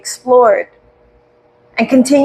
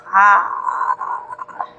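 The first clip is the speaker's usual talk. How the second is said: soft breathy ah, mouth open; audible ah; 3 semitones lower, 5 dB quieter than usual. Shown as a demonstration. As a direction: let a voiceless ah out on the breath. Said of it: "So open the mouth".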